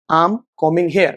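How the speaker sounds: "I am combing hair" slowly, as I'm com-ing hair; slow model "I am combing hair", contracted, B silent. Shown as a demonstration. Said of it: "will be contracted".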